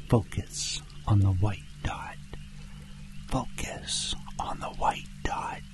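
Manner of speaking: hushed hypnotic repetition; whispered mantra. Acted. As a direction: whisper it to hold the listener's focus. Repeated focusing mantra.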